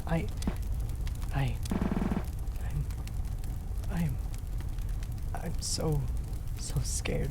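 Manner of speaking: whispered terror; scared whisper facing death; frightened whisper. Acted. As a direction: breathe the words out, terrified. Terrified, near death.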